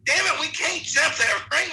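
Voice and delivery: high-pitched mock panic yell; retold panicked hollering. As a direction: holler in alarm, re-enacting your own fright. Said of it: "screaming and hollering like a little girl".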